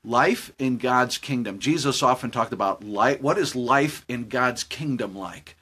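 quiet preaching. Acted. Pastor closing his story with God's kingdom, slow and low. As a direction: draw the lesson gently, slowly.

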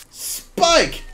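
high, sharply falling exclamation; playful attack cry. Pretend. Player attacking with metal spikes, playful attack cry.

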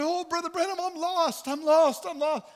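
high, loud, desperate wail of a frightened woman; panicked cry. Retold or imitated. Woman at the door pleads with Brother Branham, panicked cry. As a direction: cry out for help, pitch jumped way up.

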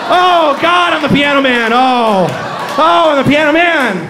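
hammed-up ecstatic cry, high and loud; mock climax. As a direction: cry out in exaggerated self-adoring ecstasy. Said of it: "the part where he, uh, he, he climaxes".

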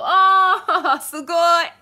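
high, loud oh, sugoi with a laugh; delighted reaction. Real, not acted. Streamer impressed by a viewer's Japanese, delighted. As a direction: marvel at them warmly.

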